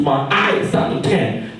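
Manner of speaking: ordinary talk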